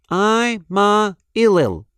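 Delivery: high, slow backward speech; talking backwards. Acted. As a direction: say it backwards like a secret code. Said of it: "She talked backwards to herself".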